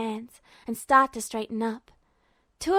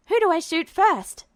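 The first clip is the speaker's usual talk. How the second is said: playful call; 5 semitones higher, 4 dB louder than usual. Acted. Hild playful, calling out.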